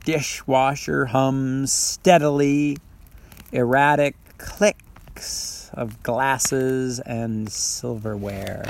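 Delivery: slow, hushed haiku reading; slow poem reading. Shown as a demonstration. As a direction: savor each word, slowly. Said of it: "I'll read it again".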